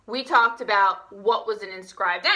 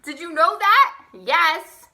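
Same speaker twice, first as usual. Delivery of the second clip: ordinary talk, nothing marked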